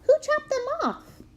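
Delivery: put-on high, slow, wide-eyed child's question; little girl wondering who took his feet; curious little girl. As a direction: ask it like a puzzled small child. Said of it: "Evelyn asked".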